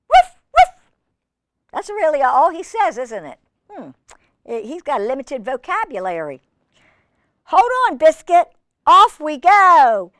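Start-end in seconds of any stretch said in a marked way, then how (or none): 0.1-0.9 high, loud double woof; puppy woof. Acted. Biscuit answering the reader, high loud puppy woofs. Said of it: "And Biscuit says". 7.4-10.1 high, loud, drawn-out send-off; excited storybook call. Acted. Sledder calling to Biscuit, high and excited.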